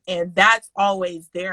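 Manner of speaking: normal talking voice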